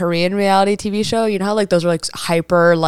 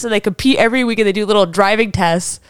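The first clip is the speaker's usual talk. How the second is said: usual speaking voice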